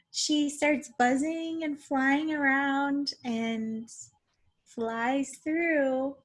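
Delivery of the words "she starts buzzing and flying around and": normal talking voice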